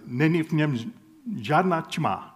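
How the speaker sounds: "Není v něm žádná tma" in halting non-native Czech; butchered Czech. Shown as a demonstration. Preacher quoting a Czech double negative to the congregation, clumsily pronounced. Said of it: "I know I just butchered it".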